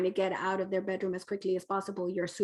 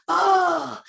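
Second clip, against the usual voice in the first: relieved sigh; 8 semitones higher, 7 dB louder than usual. Retold or imitated. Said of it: "they're like".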